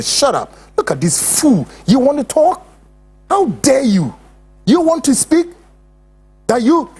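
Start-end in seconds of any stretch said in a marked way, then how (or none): 1.9-6.9 mocking angry challenge. Mocking the critic who wants to speak.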